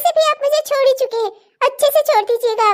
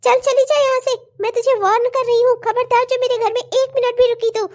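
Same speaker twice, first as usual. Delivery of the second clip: ordinary delivery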